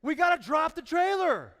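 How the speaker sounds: high, loud emphatic call; loud preaching declaration; preaching shout. Real, not acted. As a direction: proclaim it to the congregation. Preacher drives home the point.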